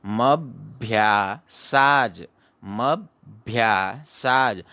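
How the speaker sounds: मभ्यासाज stretched out slowly, syllable by syllable; teacher drawing out मभ्यासाज for students; slow syllable demo. Shown as a demonstration. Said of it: "आगे पढ़ेंगे".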